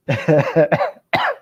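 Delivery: a sudden high burst of laughter. Real, not acted. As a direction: crack up and laugh out loud. Breaks up mid-sentence.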